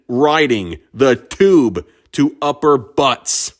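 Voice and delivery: drawn out slowly, savoring each word; slow emphatic repeat. Shown as a demonstration. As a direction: spell it out slowly for effect. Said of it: "incredibly visual that all sounds".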